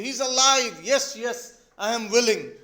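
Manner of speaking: loud, urgent cry without real tears; acted shouting. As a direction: call out in mock hysterics. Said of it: "Natalia is also shouting, crying".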